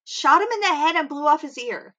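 building up the shocking part; shocked. Real, not acted. Shocked telling of a shooting.

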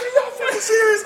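high, loud laughing disbelief; incredulous. Retold or imitated. Asking the police if they're serious, laughing in disbelief. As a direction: burst out in amazed laughter. Stunned at police ATM offer.

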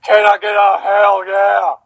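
shouted preacher call. Pretend. Host demanding a reply from co-hosts, slow mock preacher shout. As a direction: bellow it like a preacher losing patience. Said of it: "I said".

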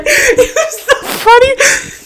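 high, squeaky words through laughter; laughing hard. Real, not acted. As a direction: barely get it out for laughing. Breaks up laughing at own story.

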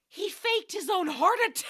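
high, laughing disbelief; can't keep from laughing. Real, not acted.

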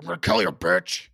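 loud, growling horror villain impression; Freddy. Asked for a best Freddy Krueger impression, growled threat. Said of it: "that's my best Freddy".

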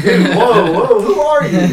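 high, loud startled whoa; shocked disbelief. Retold or imitated. Friend shocked after Colin punches stall, loud alarmed whoa.

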